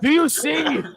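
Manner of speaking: very high mock villain cry; hammed-up movie madman; Red Dragon. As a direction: taunt a captive in a strained high voice. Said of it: "When you Red Dragon her".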